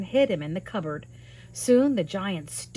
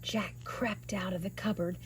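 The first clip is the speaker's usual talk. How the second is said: hushed; 2 semitones lower, 9 dB quieter than usual. A narrator's reading. Sneaking out of the cupboard.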